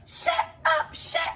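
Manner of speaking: grandma shrieking at the granddaughter; grandma yelling. Retold or imitated. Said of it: "And she said".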